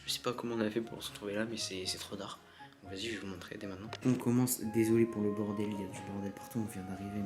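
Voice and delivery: ordinary delivery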